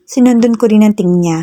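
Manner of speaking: ordinary delivery, nothing marked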